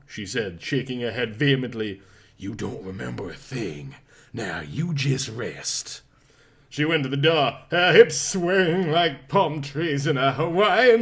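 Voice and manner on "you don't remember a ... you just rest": soothing nurse. A narrator's reading. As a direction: hush him gently to rest.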